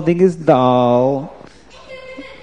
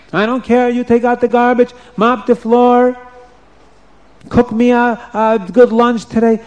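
bossy queen; 7 semitones higher than usual. Acted. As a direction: lay down the law like a cruel queen. Vashti bosses the girls.

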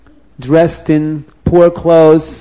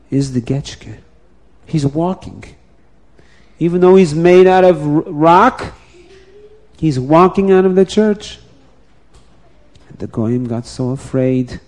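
usual speaking voice

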